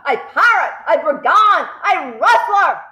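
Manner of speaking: high, loud list of insults, each harder; angry insults. A narrator's reading. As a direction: hurl every word at the boy. Headmistress listing insults.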